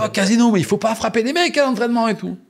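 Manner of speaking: high, loud shouted telling-off; imitated shouting coach. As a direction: holler it across the pitch. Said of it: "Casino, il m'appelle".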